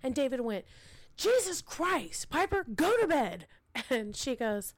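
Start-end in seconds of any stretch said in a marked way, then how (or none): 1.2-3.4 exasperated. Retold or imitated. Father telling sleepwalking daughter to bed, exasperated higher groan.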